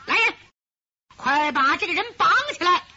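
high, loud call to the guards; loud command. Acted. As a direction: shout the order across the yard. Orders soldiers to bind her. (高声喝令)

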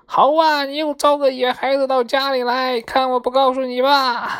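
high, loud parrot screech of outrage; parrot's loud shout. A narrator's reading. Parrot catches Maomao letting a stranger in, loud high shouting. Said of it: "绿鹦鹉大叫".

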